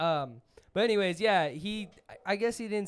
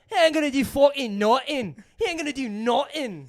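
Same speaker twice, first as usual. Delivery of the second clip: high, loud mocking trash talk; 8 semitones higher, 6 dB louder than usual. Echoing the taunt to friends, high mock trash talk. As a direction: ham up a tough guy's sneer.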